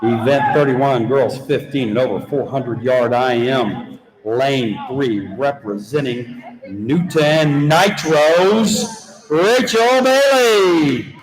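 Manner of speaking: slow, drawn-out swim meet introductions with each name swept up high; announcing finalists. Acted. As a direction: build every name into a big stadium call, the last loudest.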